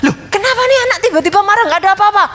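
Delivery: high, rapid-fire puzzled questioning; acted-out motherly bewilderment; baffled mother. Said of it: "mamanya ngeliatnya pusing".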